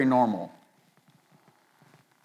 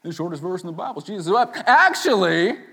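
quick setup, then high loud "Actually"; one-upping know-it-all; 7 semitones higher, 7 dB louder than usual. Acted. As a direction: pounce with a mock pedant's correction. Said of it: "use that to one-up people".

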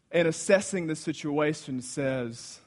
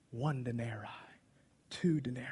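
whispered counting; 3 semitones lower, 9 dB quieter than usual. Acted. Philip counting pennies.

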